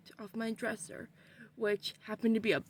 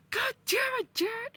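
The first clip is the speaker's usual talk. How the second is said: loud, high yell; exasperated shout; 13 semitones higher, 5 dB louder than usual. Retold or imitated. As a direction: yell at Jared in annoyance. Annoyed shout at Jared.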